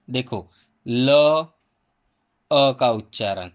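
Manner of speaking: ordinary talk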